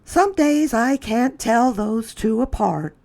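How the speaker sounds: high fond mother voice; a mother marvelling at the boys, pitched high; Mrs. Wilson's voice. A narrator's reading. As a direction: lift up into a neighbour mom. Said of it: "said Missus Wilson".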